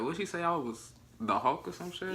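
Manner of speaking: high, indignant "the Hulk?"; playfully offended question; a touch of mock outrage; mock disbelief. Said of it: "and I was like".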